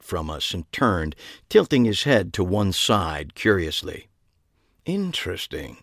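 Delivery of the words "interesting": soft, affected British musing; curious, understated British drawn from affectation; affected British accent. A narrator's reading. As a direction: muse softly in affected British. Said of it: "said in what was either a British accent".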